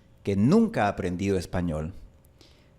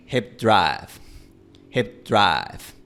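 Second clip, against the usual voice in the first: como Mark Repetto; much slower than usual. Shown as a demonstration. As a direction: drive casi sin deslizamiento final. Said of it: "como Mark Repetto". (hip drive con diptongo casi plano)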